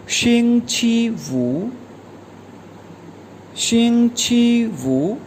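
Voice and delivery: शिंग छी वू said slowly and evenly; teacher modelling शिंग छी वू; slow model word. Shown as a demonstration. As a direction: repeat शिंग छी वू slowly for the class. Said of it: "pronounce करेंगे शिंग छी वू".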